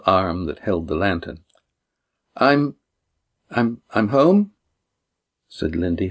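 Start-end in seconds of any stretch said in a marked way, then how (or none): 2.4-4.5 halting, stumbling slowly over words; nervous, unsure homecoming; hesitant. A narrator's reading. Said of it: "her voice unusually hesitant".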